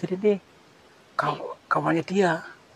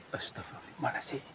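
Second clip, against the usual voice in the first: whispered astagfirullah; 16 dB quieter than usual. Real, not acted. Reacts to something eerie.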